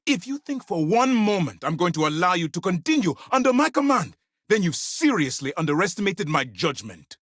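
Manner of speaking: high, forceful dressing-down; furious reprimand. Acted. Commander refusing to keep an insubordinate agent, furious. Commander rebuking challenge to authority.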